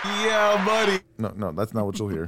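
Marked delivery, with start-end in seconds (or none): 0.0-1.0 hype shout. Pretend. Buzzer sound demo.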